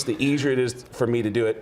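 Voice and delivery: ordinary talk, nothing marked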